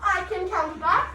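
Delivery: high, loud, slow British chant; drawn-out put-on British accent. Shown as a demonstration. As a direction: model a British accent for the class. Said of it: "in the best British accent".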